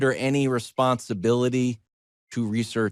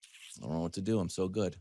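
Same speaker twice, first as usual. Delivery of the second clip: Jordan shrug quote; 3 semitones lower, 10 dB quieter than usual. Retold or imitated. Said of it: "Jordan shrug".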